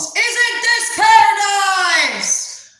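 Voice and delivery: high, drawn-out mimicked scream; screaming at top of lungs. Boss showing off his products on camera, screaming. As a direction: bellow it slowly as the loud boss. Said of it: "screams at the top of his lungs".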